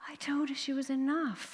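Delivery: breathless half-whisper, almost unvoiced. To the TV anchorman, a hushed whisper. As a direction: let it out on a breath like a confession. Telling anchorman what she did.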